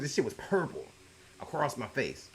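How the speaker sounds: ordinary talk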